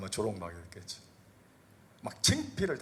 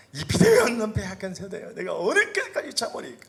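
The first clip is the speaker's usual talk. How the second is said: high, loud, exasperated rebuke; 9 semitones higher, 8 dB louder than usual. Acted. Jesus rebukes the faithless generation, loud exasperated scolding.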